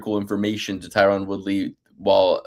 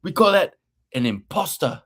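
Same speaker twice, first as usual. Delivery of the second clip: put-on high accented accusation, getting louder at the end; Hector Lombard impression; 9 semitones higher, 3 dB louder than usual. Host keeps imitating Hector Lombard, high accented accusation. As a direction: mimic Lombard calling out the imposter. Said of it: "He's like".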